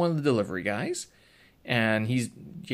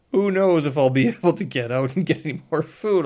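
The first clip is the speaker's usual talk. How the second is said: 1 laughing; 5 semitones higher, 7 dB louder than usual. Real, not acted. Laughing at his own doomsday rationing.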